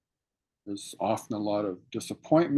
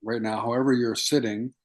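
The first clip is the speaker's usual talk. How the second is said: guided meditation. Acted. Teacher guiding students to feel their sit bones, calm meditation voice. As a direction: ease listeners into their seated bodies, unhurried.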